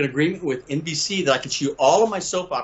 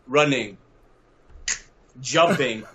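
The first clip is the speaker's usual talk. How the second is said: ordinary delivery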